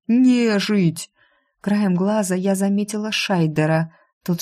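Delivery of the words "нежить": high cry of revulsion; pitched-up horrified shout; yelling. A narrator's reading. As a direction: fling it out as an accusation. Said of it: "заорал он".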